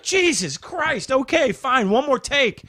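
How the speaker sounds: reluctantly giving in; exasperated director. Retold or imitated.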